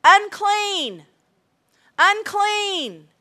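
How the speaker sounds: loud, high warning cry; acted high-pitched shout; hollering out. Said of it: "you start hollering out".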